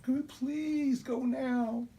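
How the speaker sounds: very high, slow, drawn-out plea; whiny begging kid. Retold or imitated. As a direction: stretch the please like a tired child. Kids begging again.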